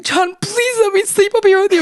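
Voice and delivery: high, whiny mock begging; retold desperate plea; pleading. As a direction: beg in a hammed-up high voice. Retold begging during a fight.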